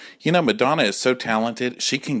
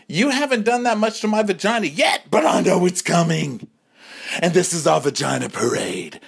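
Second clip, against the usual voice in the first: women marchers; 10 semitones higher than usual. Retold or imitated. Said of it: "It's like".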